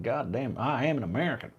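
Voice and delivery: lightly put-on American accent; half-hearted American caricature accent. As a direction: try a mock American accent. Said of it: "learn how to speak like an American".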